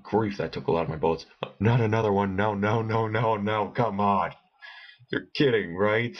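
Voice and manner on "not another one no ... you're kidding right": louder, frustrated protest at the screen; exasperated outburst. Real, not acted. Gamer spotted by another enemy, loud exasperated protest. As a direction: groan it out as things go wrong. Frustrated as more enemies come.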